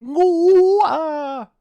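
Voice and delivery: high squealing rubber chicken noise; rubber chicken squawk. Shown as a demonstration. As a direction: squeeze out a shrill rubber chicken cry. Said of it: "That's me making the rubber chicken noise".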